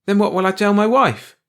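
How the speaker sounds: high, loud demand. A narrator's reading. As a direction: press hard for an answer. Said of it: "George demanded".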